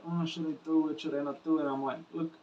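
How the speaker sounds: normal talking voice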